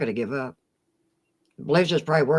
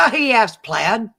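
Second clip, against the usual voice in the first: laughing as it's read out, higher and louder; amused laughter; 4 semitones higher, 7 dB louder than usual. Real, not acted. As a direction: burst out laughing into the words. Cracking up at chat joke.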